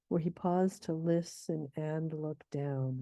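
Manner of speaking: slow, PAUSED stressed; scanning the meter. Shown as a demonstration. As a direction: mark the anapest on "Where he paused". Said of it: "that's definitely an anapest".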